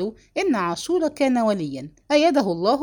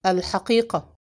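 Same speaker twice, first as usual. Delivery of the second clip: understated rough command; gruff demand. A narrator's reading. Father confronts the assembled family, demanding gruffly. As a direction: demand it brusquely, without raising the voice much. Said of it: "صاح بفظاظة".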